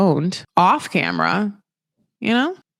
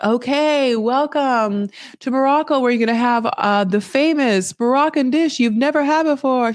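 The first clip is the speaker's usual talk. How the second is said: higher-pitched presentational host voice; welcoming host; 5 semitones higher than usual. Retold or imitated. Hosts presenting a famous Moroccan dish, welcoming spiel. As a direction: announce the dish like a restaurant host.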